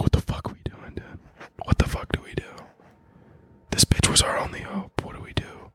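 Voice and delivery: hushed bee-in-a-crisis whispering; panicked whisper. Acted. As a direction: whisper like a hive in mock panic. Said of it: "They just all panic".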